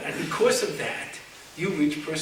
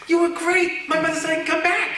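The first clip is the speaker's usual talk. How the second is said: high, loud, fast burst of thanks; imitated excited daughter; 8 semitones higher, 5 dB louder than usual. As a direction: act out a thrilled daughter bringing good news. Thrilled daughter reporting good news, retold.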